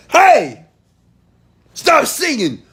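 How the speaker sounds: high, loud parental holler; retold scream. Father ordering his daughter to stop singing, screaming. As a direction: re-enact yelling at a child. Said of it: "Why did I scream?".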